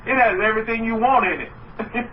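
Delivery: normal talking voice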